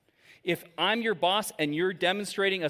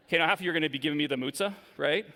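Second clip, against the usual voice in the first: usual speaking voice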